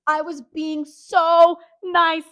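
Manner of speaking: emphatic protest. Real, not acted. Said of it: "emphatic protest".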